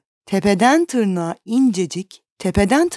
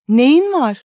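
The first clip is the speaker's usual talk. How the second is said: put-on concerned girl; 6 semitones higher, 5 dB louder than usual. A narrator's reading. Girl asks the painter anxiously.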